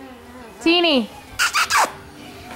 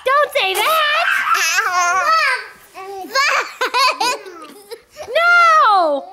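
laughing mock scold; 6 semitones higher, slower than usual. Real, not acted. Mom scolds, cracking up.

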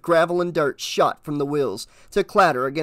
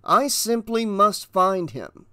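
slow, higher-pitched, earnest appeal; polite insistence; 5 semitones higher, slower than usual. Acted. Burning man seeking the other fellow, politely insistent. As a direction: press the point slowly and courteously.

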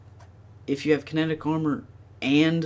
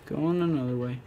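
ordinary talk, nothing marked

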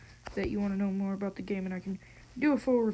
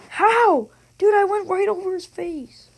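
frustrated yell; 9 semitones higher, 11 dB louder than usual. Real, not acted. Sniper shot missed in game.

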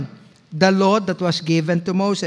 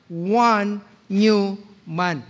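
slow emphatic reading; much slower than usual. Acted. Reading Bible verse slowly.

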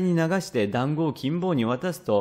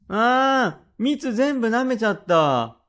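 put-on high, loud childish wail; hammed-up, drawn-out cry of protest; 9 semitones higher, 4 dB louder, slower than usual. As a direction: bawl in dismay like a robbed little boy. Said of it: "泣くんじゃねえよ。".

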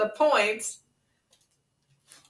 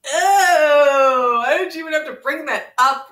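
hammed-up whiny cry, higher and louder; mock wail; 5 semitones higher, 7 dB louder than usual. Complaining to Ren about cow stomach, mock wail. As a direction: overplay the despair like a fake tantrum. Mock despair at Ren's remark.

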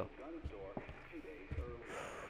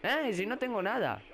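frightened; much slower than usual. Real, not acted. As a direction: dread turning to disbelief. Le dicen que mire detrás. (grito de susto, luego alivio agudo)

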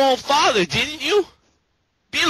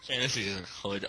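laughing mumble; 15 dB quieter, faster than usual. Real, not acted. Breaks down laughing.

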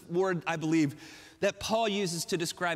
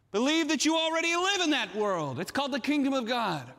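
raised, emphatic preacher's exhortation; impassioned closing call; fervent preaching; 5 semitones higher than usual. Real, not acted. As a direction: drive each charge home to the congregation.

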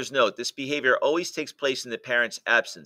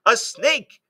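high, loud startled cry of fear; frightened shriek; 11 semitones higher, 8 dB louder than usual. A narrator's reading. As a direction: scream it out in sudden terror. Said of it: "a sight that chilled her blood".

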